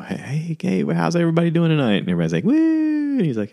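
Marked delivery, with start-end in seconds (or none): 0.0-2.0 high, fast stage-host greeting; imitating a comic's opener; hyped comedian. 2.4-3.2 high whooping "Woo!"; imitating a crowd's whoop; mock crowd cheer.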